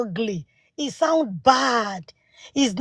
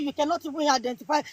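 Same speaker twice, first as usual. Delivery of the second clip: ordinary delivery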